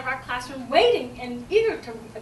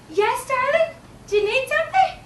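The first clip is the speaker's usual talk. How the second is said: high, loud, sugary questioning; sweet teacher reply; 11 semitones higher, 8 dB louder than usual. Acted. Teacher answers the child calling her, sweet high-pitched query. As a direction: answer a little one in a lifted teacher voice.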